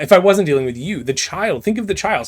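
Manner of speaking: put-on loud, rising plea about the child; pleading loudly, pitch climbing high; urgent plea. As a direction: beg her to think of the child. Hero pleads with the villain.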